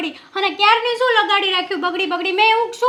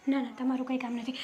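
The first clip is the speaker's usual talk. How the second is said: quiet aside; 6 semitones lower, 13 dB quieter than usual. Acted. Teasing aside to sister.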